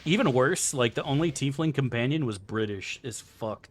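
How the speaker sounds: ordinary talk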